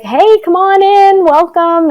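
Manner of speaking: high, slow Southern welcome; Southern accent. Retold or imitated. Southern yoga teacher welcomes a newcomer, Southern accent. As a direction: drawl it out in a friendly Southern accent. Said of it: "with a thick Southern accent".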